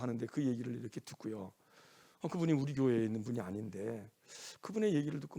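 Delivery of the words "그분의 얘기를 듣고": ordinary talk, nothing marked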